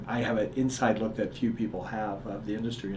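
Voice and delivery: ordinary talk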